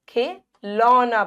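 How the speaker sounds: खिलौना slowly with wide औ; खि, then a long open लौना; open-mouthed vowel. Shown as a demonstration. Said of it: "बड़ा मुंह खुल रहा है".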